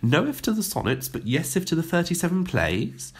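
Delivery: high, hopeful questioning; a lightly voiced hopeful teacher. A narrator's reading. Teacher pleading with the headmistress, hopefully. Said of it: "asked Miss Palaver hopefully".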